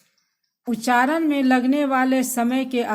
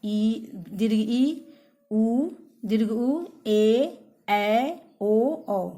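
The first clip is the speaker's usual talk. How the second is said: teacher drawing out the long vowels for the class; drawn-out long vowels; much slower than usual. Shown as a demonstration. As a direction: stretch each long vowel slowly to show its length. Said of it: "हर्ष स्वर से दुगुना समय लगता है".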